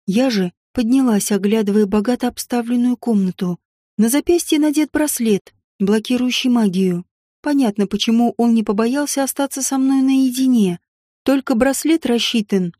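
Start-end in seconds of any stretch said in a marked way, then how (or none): none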